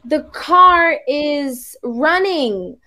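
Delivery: "The car is running" said very slowly; practising English slowly, word by word; careful slow repetition. Retold or imitated. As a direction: learner copying TV English slowly. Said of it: "if they would say".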